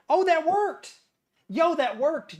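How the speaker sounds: high, loud amazed exclamation; loud, high surprise; surprised delight. Real, not acted. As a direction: blurt it as it suddenly works. Surprised success.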